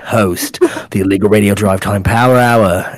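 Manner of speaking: Australian radio presenter voice, held down; Roy Malloy. Shown as a demonstration. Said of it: "I'm copying Roy Malloy".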